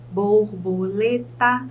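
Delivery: slow "borboleta", split bor, bo, le, ta; four measured beats in "borboleta"; syllable by syllable. Shown as a demonstration. Teacher counts syllables for students, "borboleta" syllable by syllable. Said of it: "eu chamo de polissílaba".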